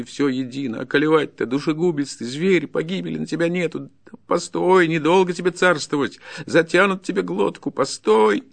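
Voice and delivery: high, loud railing; frantic peasant tirade; desperate fury. Acted. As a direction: threaten and curse with nothing left to lose. Peasant refuses to be silent, threatens.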